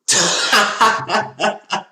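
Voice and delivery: loud, high burst of laughter; a high, loud cackle of disbelief; big laugh. Real, not acted. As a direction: break into real laughter at the pick. Reaction to Texans' pick.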